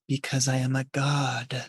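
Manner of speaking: hushed, slow anime-style aside; whispered inner monologue. Acted. Smug character's inner anime monologue, softly near-whispered. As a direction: say it softly and slowly, as if to yourself. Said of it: "he, he whispers".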